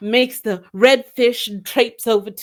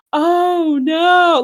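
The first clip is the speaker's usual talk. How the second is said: mock dismay to audience; 7 semitones higher, 4 dB louder than usual. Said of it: "turns out to the audience and goes".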